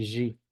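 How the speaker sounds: G said as a buzzing "zhi"; voiced zh pronunciation. Shown as a demonstration. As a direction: say G like French "j" plus ee.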